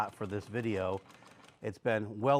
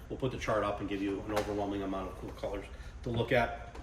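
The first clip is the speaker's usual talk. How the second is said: ordinary talk, nothing marked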